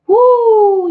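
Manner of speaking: loud, high whoop; releasing energy after a heavy message with a whoop; loud woo. Real, not acted. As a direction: let out a big high whoop. Shaking off intense reading.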